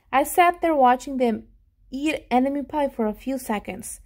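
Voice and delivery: ordinary delivery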